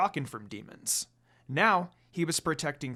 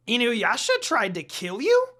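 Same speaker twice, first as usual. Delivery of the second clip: very high, loud squawk of surprise; shocked shout; 7 semitones higher, 6 dB louder than usual. A narrator's reading. Jokin hearing of the betrayal, shocked shout. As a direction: screech it in disbelief. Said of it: "Jokin shouted in surprise".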